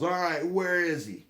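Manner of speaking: retold high-pitched, drawn-out yell; angry yell. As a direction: yell it as a livid father would. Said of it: "he just yells ... he's pissed".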